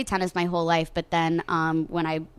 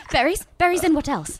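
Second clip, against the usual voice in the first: little lad voice; 9 semitones higher, 3 dB louder than usual. Retold or imitated. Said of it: "He goes".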